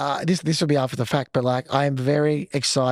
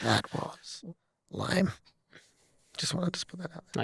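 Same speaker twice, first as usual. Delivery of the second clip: real whisper, much softer, teasing; whispered aside; 3 semitones higher, 11 dB quieter, slower than usual. Real, not acted. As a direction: murmur it off-mic like a cheeky heckle. Aside mocking the co-host's chant.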